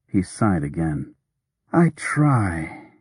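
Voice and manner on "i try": tired and drawn out; weary. A narrator's reading. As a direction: speak as if worn out. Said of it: "he said wearily".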